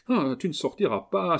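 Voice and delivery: high, loud cry to stop her; outcry. Acted. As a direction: shout her down at the door. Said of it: "s'écria Camille". (cri violent pour l'arrêter)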